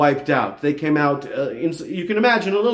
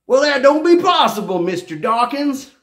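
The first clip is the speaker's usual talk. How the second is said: much higher, louder mocking Southern accent; 10 semitones higher, 4 dB louder than usual. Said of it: "making fun of Richard Dawkins, I'm using it too".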